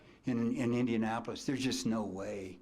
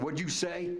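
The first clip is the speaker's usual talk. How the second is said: sharp challenge; 8 semitones higher than usual. Retold or imitated. Coach wheels on the runner.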